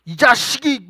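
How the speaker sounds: hammed-up parent's rebuke; mock parental scolding. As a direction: act out a parent suddenly telling the child off. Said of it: "갑자기 나한테 막 혼내".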